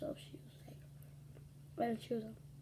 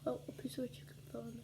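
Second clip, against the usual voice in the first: whispered aside; 10 dB quieter, faster than usual. Real, not acted. Aside while eating.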